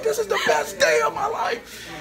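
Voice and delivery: high-pitched mock fan shriek; crazed fan excitement. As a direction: gush like an overwhelmed young fan. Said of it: "they was going crazy".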